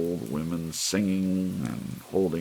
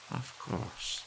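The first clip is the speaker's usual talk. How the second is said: soft realization; 12 semitones higher, 7 dB quieter than usual. A narrator's reading. Missus Bellows thinks.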